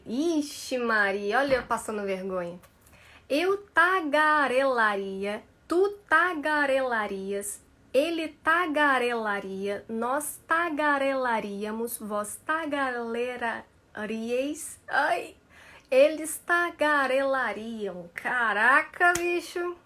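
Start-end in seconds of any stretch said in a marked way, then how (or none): 3.3-18.1 slow, careful syllables; slow conjugation. Shown as a demonstration. Teacher struggling through the hardest twister for students. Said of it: "É tudo, é uma progressão". 18.1-19.8 embarrassed. Real, not acted. Embarrassed after stumbling.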